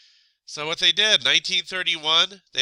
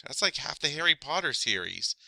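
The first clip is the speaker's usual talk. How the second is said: usual speaking voice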